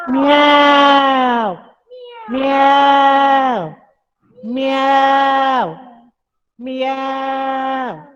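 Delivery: high, loud drawn-out meow; cat meow. Shown as a demonstration. Yoga teacher shows the cat sound to the class, loud long meow. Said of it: "How does the cat".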